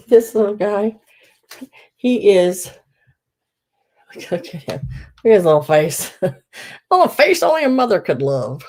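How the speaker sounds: gushing over something adorable; cooing, laughing at a cute face; overwhelmed by cuteness. Real, not acted.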